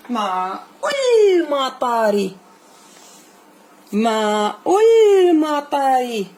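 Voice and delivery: very slow, drawn-out reading aloud; a teacher's slow, careful model read. Shown as a demonstration. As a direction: stretch each word slowly for the pupil. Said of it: "teacher models slow reading".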